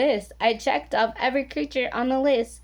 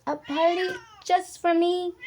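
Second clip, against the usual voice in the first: croaked surprise; 7 semitones higher than usual. Acted. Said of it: "I croaked".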